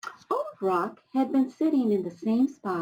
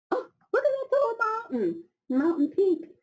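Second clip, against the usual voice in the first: high excited look-at-that; delighted exclaim; 13 semitones higher than usual. Real, not acted. Reader excitedly showing children the mountain picture. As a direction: point it out with delight.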